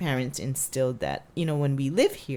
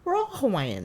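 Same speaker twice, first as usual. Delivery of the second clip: lightly voiced grandma, higher and cheery; sweet grandma soothing a child; grandma reassuring; 10 semitones higher than usual. Grandma telling the child she is Hawaiian, light high voice. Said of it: "my grandma said".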